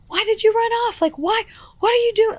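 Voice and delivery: high-pitched mock scolding from a worried mother; imitating frantic mom. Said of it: "being like".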